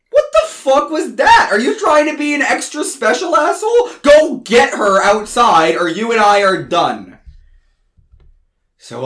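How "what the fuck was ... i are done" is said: angry scolding. Acted. Tim scolds narrator.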